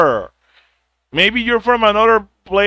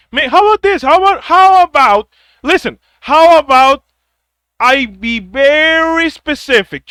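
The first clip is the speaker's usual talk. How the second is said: louder and higher, fed up; exasperated outburst; 5 semitones higher, 4 dB louder than usual. Real, not acted. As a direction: raise your voice in frustration. Rising exasperated rant.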